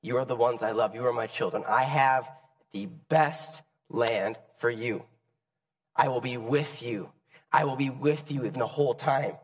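soft, tender voice of God. Acted. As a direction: speak gently as a loving God. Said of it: "God basically said".

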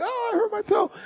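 high, soft little whimper; mock whimper. Pastor imitating himself stubbing a toe, high whimper.

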